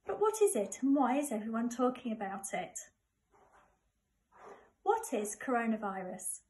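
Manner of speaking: plain reading voice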